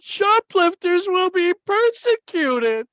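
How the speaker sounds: put-on very high, loud, drawn-out child's wail; hammed-up high-pitched bawling; wailing little boy. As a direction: cry it out slowly like a terrified child. Voicing tearful boy reading the sign.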